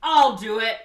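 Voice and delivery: loud, high melodramatic leading man; hammed-up outcry of betrayal; Cary Grant. Betrayed man giving her to the police, mock anguished shout. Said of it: "Cary Grant's like".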